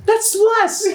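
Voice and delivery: very high-pitched squawked protest; goblin. Acted. Goblin haggling over the price, high squawk. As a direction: squeal the objection in a put-on creature voice. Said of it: "The goblin nods and goes".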